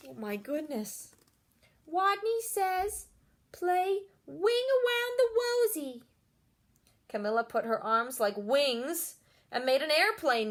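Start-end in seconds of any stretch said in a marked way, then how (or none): 1.9-6.0 high, slow, small voice; a shy kid daring to speak up; timid Wadney. A narrator's reading. Wadney calling the next order to the class.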